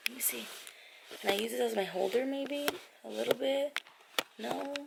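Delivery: hushed aside; quiet. Real, not acted. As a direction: keep it hushed. Talking quietly while eating.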